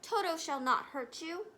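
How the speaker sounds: urgent call that eases at the end. A narrator's reading. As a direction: call after the fleeing mice. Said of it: "he called to the mice".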